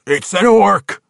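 high, loud gruff scoff; dismissive dwarf. Acted. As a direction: brush it off. Winko dismissive.

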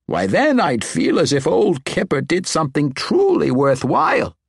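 put-on high-pitched, emphatic, excited old wizard; exuberant old wizard. Old wizard urges his new protege to accept, exuberant. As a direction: urge it on with high, swooping enthusiasm.

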